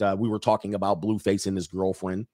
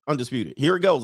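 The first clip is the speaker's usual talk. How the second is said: ordinary talk, nothing marked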